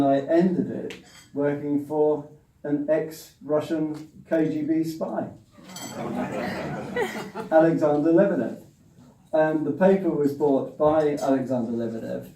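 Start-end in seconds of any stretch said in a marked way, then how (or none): 5.8-7.4 breathy laugh. Real, not acted. Laughing at the absurd career turn.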